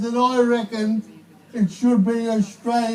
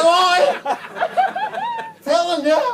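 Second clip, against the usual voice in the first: rough Aussie warning; 4 semitones higher than usual. Pretend. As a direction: bark it like a mate warning you. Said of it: "and go".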